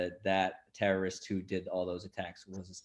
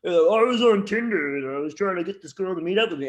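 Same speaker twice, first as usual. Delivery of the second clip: high loud mocking comic; 9 semitones higher, 8 dB louder than usual. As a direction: parody a lazy comedian. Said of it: "These people getting up".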